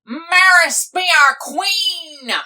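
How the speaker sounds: high, loud, drawn-out cry of alarm; shocked cry. Acted. Captain crying out as he learns who rules, dismayed cry. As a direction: shout it out in sudden dismay.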